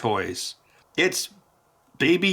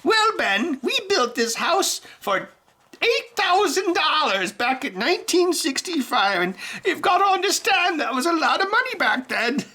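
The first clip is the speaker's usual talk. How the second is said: high, put-on elderly woman lecturing; mock old neighbour voice talking to Ben; old lady voice. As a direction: do a grandmotherly neighbour explaining. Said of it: "she doesn't talk like that".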